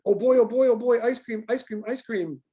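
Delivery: high, eager doggy chatter; giddy pup babbling about ice cream; excited dog inner voice. Acted. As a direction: voice a dog that can't contain itself. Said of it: "two dogs saying".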